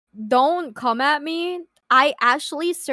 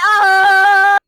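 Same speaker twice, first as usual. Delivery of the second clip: loud groan; 7 semitones higher, 12 dB louder than usual. Real, not acted. Embarrassed cry.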